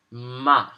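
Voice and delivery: MA falling at once; fourth tone. Shown as a demonstration. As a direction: drop the pitch immediately on "ma". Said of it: "just dropping your pitch immediately".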